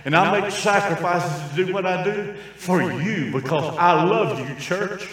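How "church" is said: ordinary delivery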